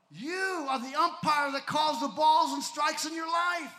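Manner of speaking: loud, high preacher's shout; fiery preaching. Acted. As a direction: preach it hard and loud to the crowd. Preaching at full force.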